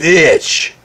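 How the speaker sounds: sudden high, loud curse; angry outburst. Real, not acted. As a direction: explode on the one word.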